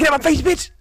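high, loud furious snap; angry shout. Pretend. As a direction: yell him off in annoyance. Annoyed rapper in imagined scene.

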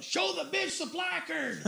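retold yell. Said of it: "Kathy yells out".

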